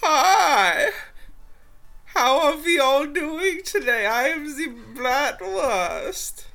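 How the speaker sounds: high, loud goofy character voice; squeaky cartoon greeting; silly high voice. Goofy character intro on podcast.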